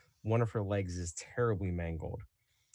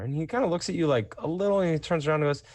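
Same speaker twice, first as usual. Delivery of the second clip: ordinary talk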